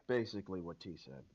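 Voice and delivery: ordinary delivery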